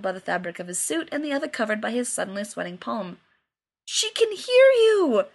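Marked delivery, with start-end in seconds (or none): 3.9-5.3 high, slow, outraged cry; shocked he said it near the baby; scandalized yelp about the baby hearing. Acted. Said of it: "Jensen, scandalized".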